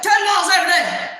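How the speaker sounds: high, fast mimicked shout; barking loud boss. Boss greeting her in Florida with training orders, shouting. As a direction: fire it out like a loud coach. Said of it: "he's loud".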